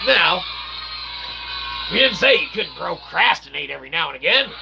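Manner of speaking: ordinary delivery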